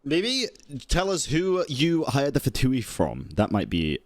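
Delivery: ordinary delivery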